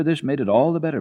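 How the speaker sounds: usual speaking voice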